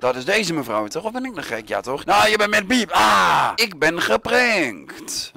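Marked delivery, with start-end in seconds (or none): 2.0-5.3 loud, high cry, slowing at the end; mock outrage. Gamer finding out he got pranked, loud mock-outraged cry. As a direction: shout it high, then stretch the last words.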